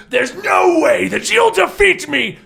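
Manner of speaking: high, slow, shouted villain boast. Acted. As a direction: gloat like a cartoon captain. GM voices villain boasting at wheel.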